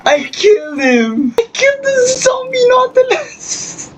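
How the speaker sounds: high, anguished shocked wail; shocked cry. Real, not acted. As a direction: cry out in horror. Accidentally kills rare mob.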